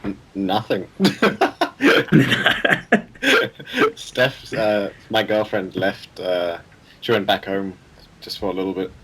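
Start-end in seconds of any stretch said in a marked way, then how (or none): 0.9-3.9 sudden high-pitched laugh; rising laugh. Real, not acted. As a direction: burst out laughing. Laughing at own reply.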